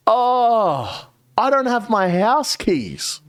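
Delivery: mock Steve playing helpless at the door; whiny Steve voice. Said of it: "Steve says".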